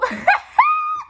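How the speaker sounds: loud, very high cackle; real helpless squeal of laughter; shrieking laugh. Real, not acted. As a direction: burst out in a high-pitched cackle. Cracks up at own retelling.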